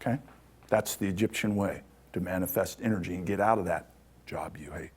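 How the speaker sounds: ordinary talk, nothing marked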